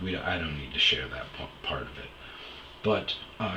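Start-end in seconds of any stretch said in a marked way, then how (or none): none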